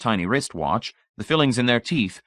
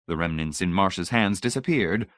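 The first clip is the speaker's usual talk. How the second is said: usual speaking voice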